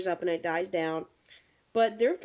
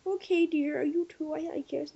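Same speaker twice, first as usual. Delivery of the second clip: lightly voiced little old lady, higher; sweet old lady; 7 semitones higher than usual. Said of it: "she goes".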